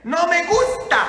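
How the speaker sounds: loud, high, emphatic protest; defiant declaration. Acted. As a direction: face it and say it out loud. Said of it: "Enfrenta tus sentimientos y dile".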